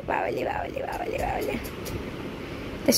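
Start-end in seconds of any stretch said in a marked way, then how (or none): 0.1-1.5 soothing whisper. Real, not acted. Parent cuddling child before sleep, soothing whisper. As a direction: whisper it like a bedtime coo.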